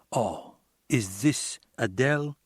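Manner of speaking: put-on a lightly done Cuban accent, slow and careful; a male caller with a hint of a Cuban accent, asking slowly. A narrator's reading. Caller on the phone asking for Adele, in a light Cuban accent. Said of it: "with an accent, Cuban or one of those".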